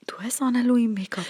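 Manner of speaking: whispered foreign-sounding line; whispered quote. Retold or imitated. Imitating a coworker's remark in a whisper.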